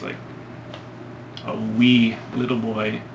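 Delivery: normal talking voice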